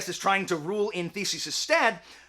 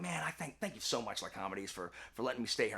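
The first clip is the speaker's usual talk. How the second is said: very soft, low thanks; quiet, casual undertone; hushed gratitude; 9 semitones lower, 13 dB quieter, faster than usual. Acted. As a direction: murmur a humble thank-you. Acted chat during a walk.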